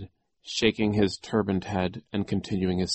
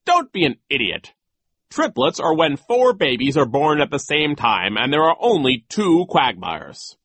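loud, raised, pompous lecture; know-it-all dismissal; scornful vice principal; 7 semitones higher, 9 dB louder than usual. Acted. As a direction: scold the girl as an idiot. Voices Nero lecturing.

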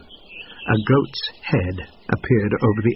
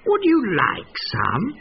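sly offer; 9 semitones higher, slower than usual. A narrator's reading. Fox luring the goat.

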